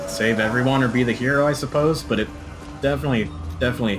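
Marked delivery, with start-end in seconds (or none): none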